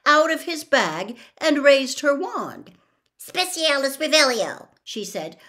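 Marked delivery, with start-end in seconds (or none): 3.2-4.7 higher, louder, crisp incantation; brisk commanding spell; casting a spell. A narrator's reading. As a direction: cast it smartly like a spell. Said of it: "rapping it smartly".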